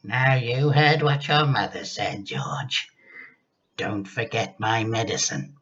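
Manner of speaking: put-on a sly old woman giving orders; a grumpy grandma bossing the boy; wicked grandma. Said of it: "opened one wicked little eye and said".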